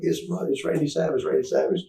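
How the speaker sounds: high, excited retold murmur of recognition; starstruck recognition. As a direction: point out a celebrity, acted out. Police recognizing a celebrity, acted out.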